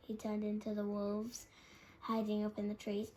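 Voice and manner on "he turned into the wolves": usual speaking voice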